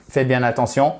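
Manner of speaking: normal talking voice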